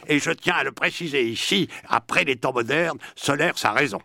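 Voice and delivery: loud, high-pitched declaiming impersonation; Sartre. Acted. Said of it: "Je vais le dire avec la voix de Sartre".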